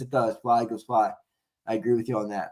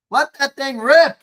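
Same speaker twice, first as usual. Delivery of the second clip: fired-up urging; 9 semitones higher, 7 dB louder, slower than usual. Real, not acted. Urging the coach again.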